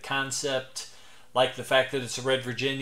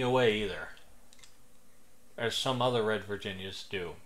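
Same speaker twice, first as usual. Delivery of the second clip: muffled lips around stem; talking around pipe stem; 3 semitones lower, 4 dB quieter than usual. Real, not acted. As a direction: pipe clenched in teeth. Seen 2: Pipe stem between lips from -2.0 on; lowered at 5.5.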